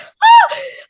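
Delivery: high loud excited squeal of laughter. Real, not acted. Fan recalling her idol, squealing laugh. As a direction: shriek with delight. Excited about meeting Rhea.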